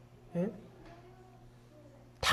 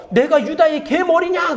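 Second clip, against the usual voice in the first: indignant retort, higher and slow; acted angry outburst; 4 semitones higher, slower than usual. Abner flares up at the king's accusation, acted indignant anger. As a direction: throw it back in acted outrage. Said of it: "그러면서 화를 내는 거예요".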